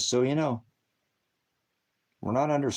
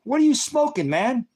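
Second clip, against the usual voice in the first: much higher and louder, disbelieving; loud, high exasperated shout; incredulous yell; 14 semitones higher, 5 dB louder than usual. Real, not acted. Yelling at troll in chat.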